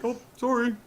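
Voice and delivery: put-on high-pitched apologetic Canadian accent; mock-polite Canadian cop apology. Canadian cop apologizing during an arrest, sheepish Canadian accent. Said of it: "Canadian cops".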